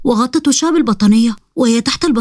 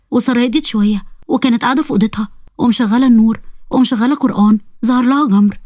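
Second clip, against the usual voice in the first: ordinary delivery, nothing marked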